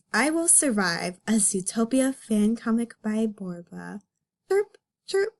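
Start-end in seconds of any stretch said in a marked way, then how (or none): 4.5-5.3 high squeaky chirp; high cartoon chirp; chirping sound effect. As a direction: chirp it out like a tiny bird. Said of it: "my voice acting skills are top notch".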